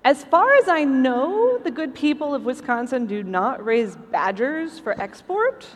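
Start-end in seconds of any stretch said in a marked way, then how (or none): none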